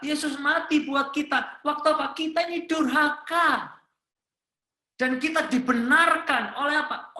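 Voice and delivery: loud, high, urgent preacher voice; impassioned preaching at full volume; full-force preaching. Real, not acted. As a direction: drive the sermon home, pitched high and loud.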